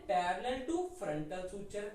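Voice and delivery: very slow, stretched words; slow read-back. Shown as a demonstration. Teacher reading notes slowly off the board. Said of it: "देखो यहां क्या लिखा हमने".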